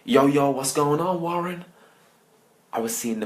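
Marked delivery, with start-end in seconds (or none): 0.0-1.7 loud, higher movie-hero holler; mock American action-star greeting. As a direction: call it out like a cocky buddy-cop star. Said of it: "waiting for [name] to pop out and say".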